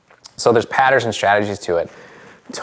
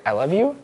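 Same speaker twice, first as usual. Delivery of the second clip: higher "I love you" climbing to the end; "you" lifted right up in "I love you"; rising question tune; 6 semitones higher than usual. Shown as a demonstration. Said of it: "physiology that goes like".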